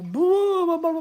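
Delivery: drawn-out blah-blahs rising high; mock babble. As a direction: babble nonsense as if filming yourself. Mimicking himself talking to camera.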